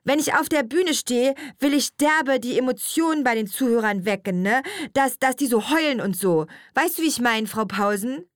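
put-on high-pitched teenage girl, full of herself; self-important schoolgirl. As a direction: announce it like big news. Girl talks about her singing.

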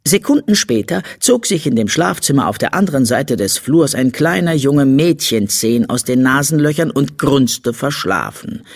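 ordinary delivery

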